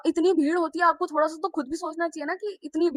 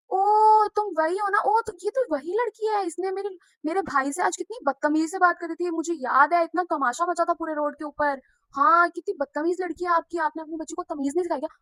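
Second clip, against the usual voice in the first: much higher and loud, outraged recognition; accusing relative; 5 semitones higher, 4 dB louder than usual. Retold or imitated. Uncle's brother recognizing the girl at the door, outraged. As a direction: point at her and blame the father.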